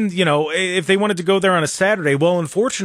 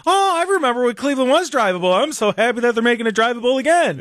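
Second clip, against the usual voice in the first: higher, gushing fake delight; mock excited citizen; 8 semitones higher than usual. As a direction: parody a gushing local. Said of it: "everybody's gonna go".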